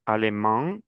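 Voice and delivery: "Alemán" with a nasal final "an", the n not sounded; nasal vowel. Shown as a demonstration. ("Alemán" con la vocal final nasal)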